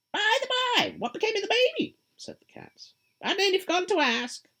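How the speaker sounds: high, loud, sly grinning cat voice; a teasing cat, pleased with itself; Cheshire Cat. Acted. The cat answering lost Alice, high and sly.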